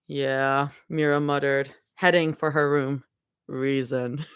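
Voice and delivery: low, steady read-aloud of a fanfic chapter; story narration. A narrator's reading. Streamer reading a fanfic chapter to chat, low and steady. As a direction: read it like a cozy audiobook.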